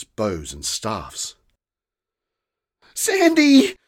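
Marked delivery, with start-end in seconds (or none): 2.9-3.8 high ringing cry of a name; a girl calling her fairy guardian loudly. A narrator's reading. Girl cries out to her fairy guardian, high and loud. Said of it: "cried Rosa".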